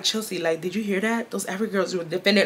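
low, soft aside to a friend; hushed, quick private remark; not loud. Retold or imitated. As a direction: say it quietly, just between friends. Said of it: "I wasn't even loud when I was saying it".

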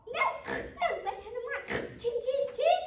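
very high, loud piggy voice; high-pitched pig at the door; little pig's refusal. Acted. Second pig refuses the wolf.

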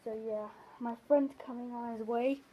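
muffled behind full face mask; speaking through a rubber mask. Real, not acted. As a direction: mouth covered by latex mask. Seen 0: Green rubber mask covers whole face while speaking; lifted off by 3.3.

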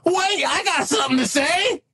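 high-pitched interjecting AI voice; Leroy. Acted. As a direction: pipe up in a high robot-sidekick voice. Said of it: "you hear Leroy's voice".

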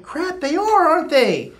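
high-pitched mock astonishment; sudden realization. Agreeing with Nigerian guy about homeless, sudden realization. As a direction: concede it in high-voiced surprise. Acted realization in retold chat.

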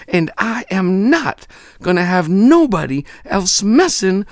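put-on high, loud scolding girl voice; angry girl's tirade. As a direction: tell off the bully, building to the question. Amanda scolds the bully.

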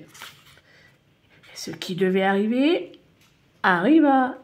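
very slow stretched phrase then a punch; suspenseful drawn-out build. A narrator's reading. Narrator builds suspense for the children, slow then punched. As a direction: hold the suspense then drop it.

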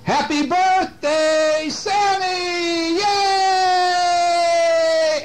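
high, drawn-out group cheer; surprise party cheer. Acted. Friends surprise Sammy at his party, cheering. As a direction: shout it high and stretch every word. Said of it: "and then he hears".

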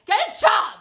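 high, punchy dismissal; scornful shout. Real, not acted. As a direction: yell it at a moocher. Snaps back at mimicked complaint.

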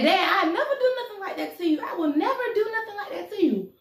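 very high, protesting plea; pleading daughter. Acted. Daughter swears loyalty to her accusing dad, pleading. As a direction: plead innocence in a high-pitched wail.

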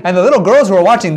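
plain reading voice

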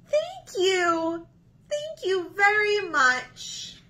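a cartoon bird melting with gratitude; grateful pigeon. Pigeon thanking the Duckling for the cookie, high and amazed.

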